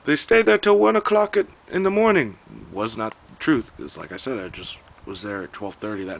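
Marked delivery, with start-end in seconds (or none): 0.0-2.4 high, loud imitated Filipino accent; heavy Filipino accent. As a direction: put on a thick Filipino accent, loud and pitched way up. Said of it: "very heavy Filipino accent".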